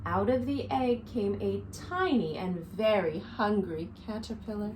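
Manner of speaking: lively storybook read-aloud for little ones; reading to children. A narrator's reading. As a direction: read it to a circle of kids, counting along. Reads picture book to children.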